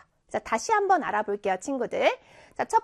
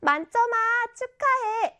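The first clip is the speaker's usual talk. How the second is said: high-pitched, delighted congratulating voice; hearty congratulations; 10 semitones higher than usual. Shown as a demonstration. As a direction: congratulate a friend with real gusto, pitch lifted. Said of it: "굉장히 축하하는 목소리로". (굉장히 축하하는 목소리)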